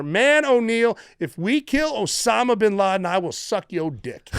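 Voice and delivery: imitating a teammate's emphatic vow; imitated driver. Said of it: "the dude driving said to me, and I quote".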